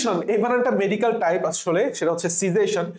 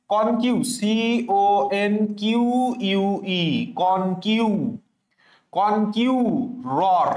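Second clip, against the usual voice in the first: কনকিউরর drawn out very slowly; teacher models কনকিউরর slowly; slow pronunciation; much slower than usual. Shown as a demonstration. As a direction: give কনকিউরর one long careful read. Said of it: "আমরা B পড়ব".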